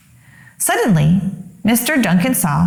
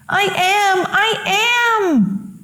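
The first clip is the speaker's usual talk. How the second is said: longing shout; 11 semitones higher, slower than usual. A narrator's reading. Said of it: "Sylvester wanted to shout".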